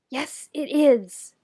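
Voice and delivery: put-on drawn-out childish yes-it-is; stubborn insistence. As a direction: dig in and win the point. Keith insists back.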